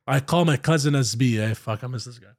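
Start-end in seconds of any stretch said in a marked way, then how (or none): none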